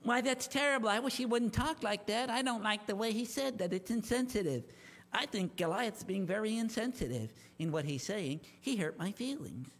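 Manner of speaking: pouting mock-hurt voice, low and soft; mock offended whiner. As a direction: sneer at the thin-skinned by playing them small and hurt. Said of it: "maybe they said".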